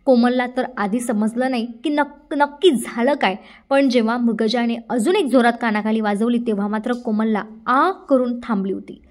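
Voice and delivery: plain reading voice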